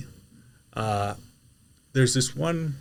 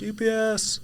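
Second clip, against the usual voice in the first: high, light doorstep call; high-pitched courier announcing himself; delivery call-out; 12 semitones higher than usual. Retold or imitated. Calling out at a customer's door.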